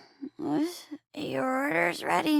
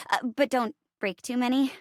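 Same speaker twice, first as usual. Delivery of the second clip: usual speaking voice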